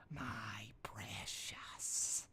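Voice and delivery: very slow, soft whisper; drawn-out whispered My precious; creepy precious whisper. A narrator's reading. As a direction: breathe it like Gollum clutching treasure. Derpy possessive over muffin.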